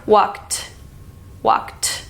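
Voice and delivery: "Walked" ending in an aspirated "t"; "Walked" with a puff of breath on the final "t"; ED as breathy t. Shown as a demonstration. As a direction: pull the tongue down and breathe out on "Walked". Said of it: "breathe out".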